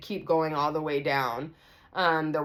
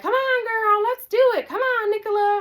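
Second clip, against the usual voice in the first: very high, loud, sing-song coaxing; mock squeal of a friend egging you on; 7 dB louder than usual. Friend urges narrator to join in, high egging-on squeal.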